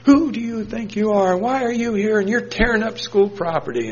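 ranting and raving in his face; retold screaming rant. Angry guy screaming at young volunteer, high mock yell. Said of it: "he's screaming at me".

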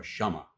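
Shama with an open a in the first syllable; pronunciation variant. Shown as a demonstration. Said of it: "depending on how it's pronounced".

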